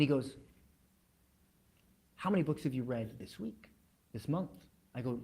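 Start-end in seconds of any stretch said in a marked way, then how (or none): 2.2-4.5 much softer, serious question; real serious. Retold or imitated. Billionaire boss repeats his question to salesman, quietly serious. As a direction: press gently but gravely. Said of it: "he gets real serious".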